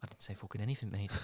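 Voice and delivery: under the breath, menacing; quiet menace. Acted. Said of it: "he goes".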